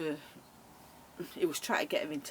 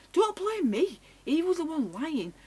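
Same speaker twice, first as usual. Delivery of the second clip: much higher and louder, outraged; defending herself to an angry friend; indignant protest; 9 semitones higher, 5 dB louder than usual. Retold or imitated. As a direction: throw the blame back at her.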